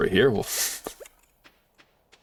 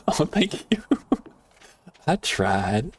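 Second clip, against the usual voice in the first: normal talking voice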